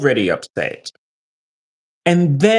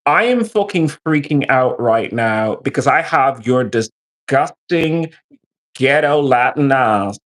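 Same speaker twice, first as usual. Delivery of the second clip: ordinary delivery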